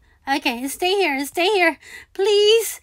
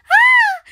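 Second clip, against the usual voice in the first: sudden high yelp of shock; high startled shriek; startled scream; 6 dB louder than usual. Real, not acted. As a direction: shriek as you fall. Sudden death in game.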